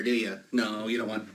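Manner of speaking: ordinary talk, nothing marked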